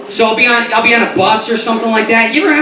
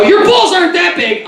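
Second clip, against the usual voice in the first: much higher blurted jab; blurted retort; 10 semitones higher than usual. Retold or imitated. To the man on the bus, a high blurting jab. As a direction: snap it out at the manspreader. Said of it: "Finally I go".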